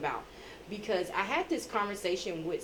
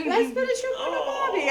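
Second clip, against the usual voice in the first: put-on loud, very high child copying an Australian accent; son's Australian accent; 5 dB louder than usual. Son with teddy bear echoing parent, loud Australian accent. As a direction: shout it as a little boy's mock-Australian. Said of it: "My son come up with his teddy bear".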